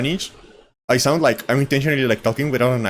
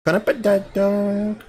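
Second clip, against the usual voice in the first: slow, higher mock Southern drawl; attempted Southern accent; 7 semitones higher, much slower than usual. Repeating the meme line to a friend, in a slow, higher Southern drawl. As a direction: try out a Southern twang on the line. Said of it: "I wish I knew how to do a southern accent".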